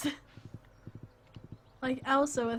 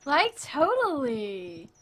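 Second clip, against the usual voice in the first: put-on very slow, swooping American valley-girl accent; exaggerated American girl drawl; American girl talk; 3 semitones higher, 5 dB louder, much slower than usual. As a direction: stretch it out like a mock American girl. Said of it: "can speak my language".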